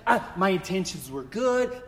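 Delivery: ordinary delivery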